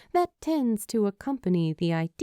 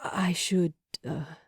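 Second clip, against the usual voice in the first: hesitant whisper; unsure, barely voiced. A narrator's reading. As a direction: trail off in a breathy hush. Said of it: "he whispers".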